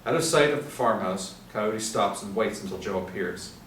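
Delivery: ordinary talk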